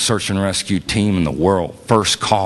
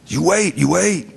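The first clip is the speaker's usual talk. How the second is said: much higher, imitated Spanish-accented order; 11 semitones higher than usual. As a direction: give the doctor's command in a Spanish accent. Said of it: "says it in his very Spanish accent".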